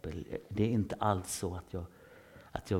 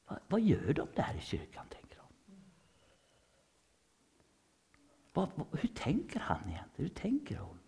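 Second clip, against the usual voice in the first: higher whispered wondering; curious inner whisper; 7 semitones higher, 3 dB quieter than usual. Acted. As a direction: voice the people's secret curious thoughts. (nyfikna tankar, viskat)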